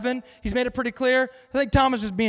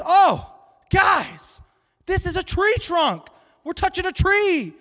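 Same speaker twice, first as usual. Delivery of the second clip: high, loud, amazed call to the guys; excited discovery; 9 semitones higher, 5 dB louder, slower than usual. Acted. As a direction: act out a thrilled announcement, pitch jumping up. First blind man's excited discovery.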